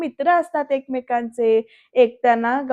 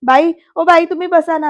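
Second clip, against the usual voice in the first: loud, higher-pitched pupil's plea; calling to teacher; 5 semitones higher, 8 dB louder than usual. Retold or imitated. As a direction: imitate a boy calling out to get the teacher's attention. Boy calls out to teacher.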